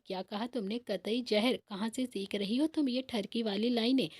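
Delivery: plain reading voice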